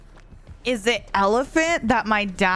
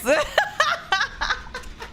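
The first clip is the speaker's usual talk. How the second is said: high giggle; 14 semitones higher than usual. Real, not acted. Laughs at chat.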